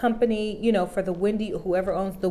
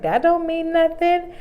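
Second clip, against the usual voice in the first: a hint of a sassy old lady, slow and higher; sassy grandma; 7 semitones higher, slower than usual. Imitating grandma brushing off the wife remark, sassy and slow. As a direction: mimic the grandma's sass, drawn out. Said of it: "so sassy".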